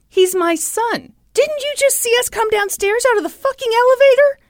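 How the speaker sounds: acted-out angry protest, pitched high; exasperated outrage. Father defending his son to the manager, exasperated.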